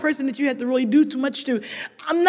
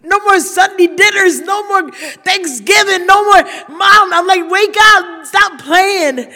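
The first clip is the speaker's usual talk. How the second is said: loud, raw cry of grief; anguished shout; 8 semitones higher, 8 dB louder, slower than usual. Retold or imitated. Son shouting at his dead mother, anguished. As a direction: shout at her to wake up.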